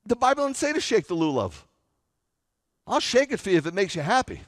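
ordinary delivery

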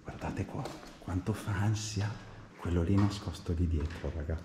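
normal talking voice

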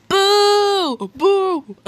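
mock outraged booing, loud; jeering crowd of one. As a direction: boo them off the stage. Booing a ship loudly.

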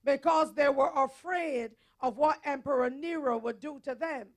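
forceful, declarative sermon delivery; preaching. Acted. Preacher telling the congregation of Paul before Nero, forceful preaching. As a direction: preach it with hard-hit key words and firm pauses.

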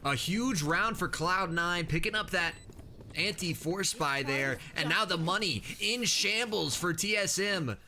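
upbeat tournament play-by-play voice; esports caster. Acted. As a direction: cast it like a pro broadcast. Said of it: "how you so good with that".

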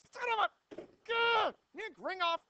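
high, loud yelp of frustration; exasperated outburst. Real, not acted. Teacher cursing Nick Ringoff mid-lesson, high exasperated outburst. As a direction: burst out as if cursing a sudden mishap. Sudden mishap while writing on board.

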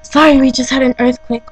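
rattled right after a quake; shaken and startled. Real, not acted.

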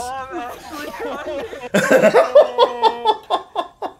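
long, high, wheezing laughing fit; hysterical laugh. Real, not acted. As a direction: laugh helplessly with relief. Overwhelmed after the win.

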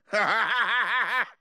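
hearty run of gloating ha-has; taunting laugh. Acted. Victor asking loser who beat them, taunting laugh.

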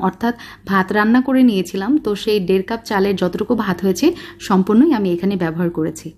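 usual speaking voice

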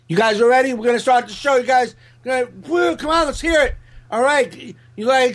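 mock the host begging the crowd like a kid; hyped emcee. Host hyping a rowdy crowd to bring comic up, high and pumped. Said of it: "What the fuck are you doing?".

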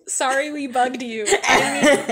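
laughing through the apology; giggling through a nervous apology; laughing while voicing. [name] voiced, laughing through.

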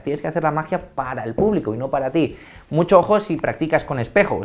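ordinary talk, nothing marked

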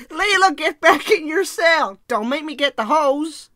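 loud, high, drawn-out yell imitating a film character; mock angry shout. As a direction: holler it slowly at full volume, hammed up. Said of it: "when he shouts".

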